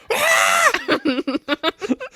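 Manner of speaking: piercing high mock wail; long, very high fake cry; hammed-up child's wail. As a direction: bawl like a lost little girl. Lost girl's wail, acted.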